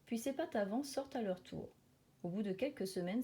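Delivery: ordinary delivery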